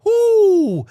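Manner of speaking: loud, high drawn-out "ooh"; high whooping ooh. Real, not acted. Preacher exclaims at hidden evil.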